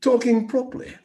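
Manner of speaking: mock Queen's English, RP accent of a vintage announcer; received pronunciation. Shown as a demonstration. Commentator redoing the BBC accent on request, mock received pronunciation. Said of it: "do the accent again one more time".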